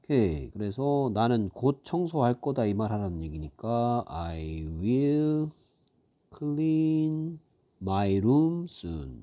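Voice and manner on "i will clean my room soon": slow model reading. Shown as a demonstration. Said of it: "teacher models English line".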